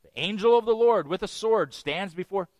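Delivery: ordinary talk, nothing marked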